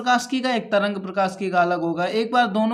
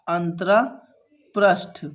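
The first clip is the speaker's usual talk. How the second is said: slow, lower, word broken in parts; slow dictation; 4 semitones lower, much slower than usual. Real, not acted. Teacher writing a term for students, slow dictation.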